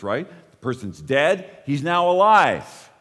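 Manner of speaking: usual speaking voice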